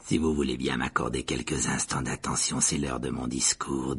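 low, formal announcement; ghostly host's speech voice; speaker addressing the room. A narrator's reading. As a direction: call for attention in a deep, stately voice. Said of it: "dit Nick d'une voix forte".

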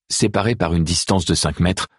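ordinary delivery

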